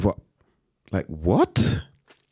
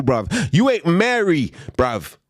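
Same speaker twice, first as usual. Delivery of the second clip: ordinary talk, nothing marked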